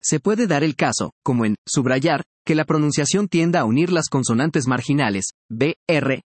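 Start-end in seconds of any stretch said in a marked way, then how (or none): none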